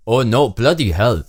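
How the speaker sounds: put-on comic Indian accent cry for help. Stranded man's message read out, mock Indian accent. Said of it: "read this and convey this message".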